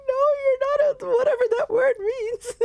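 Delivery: very high, loud squeaky mock sob; crying along. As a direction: wail the reassurance through pretend tears. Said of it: "crying a little".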